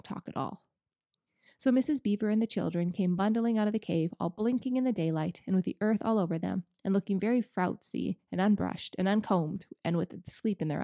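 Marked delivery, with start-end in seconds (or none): none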